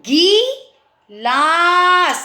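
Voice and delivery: गिलास drawn out very slowly as गि लास; teacher breaking up a word; syllable by syllable. Shown as a demonstration. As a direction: stretch गिलास into its parts. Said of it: "अगर हम ग में बड़ी की मात्रा लगाते".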